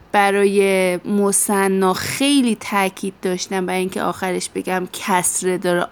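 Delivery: ordinary delivery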